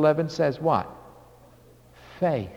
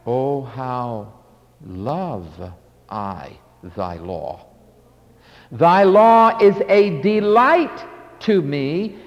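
tender psalm recital; 5 semitones lower, slower than usual. Retold or imitated. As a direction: savour each word like a prayer.

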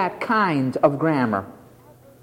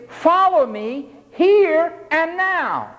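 commanding; 8 semitones higher, slower than usual. Acted. Jesus's command voiced.

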